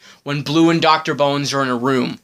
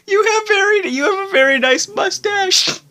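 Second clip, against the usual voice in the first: mock echo; 3 dB louder than usual. Repeating the random compliment.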